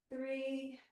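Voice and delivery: ordinary talk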